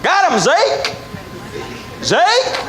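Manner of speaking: high, questioning call; calling to partner. Retold or imitated. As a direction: call high, waiting for an answer. Hunter calls out to his shot friend.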